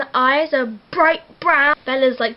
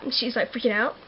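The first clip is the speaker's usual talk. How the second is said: ordinary talk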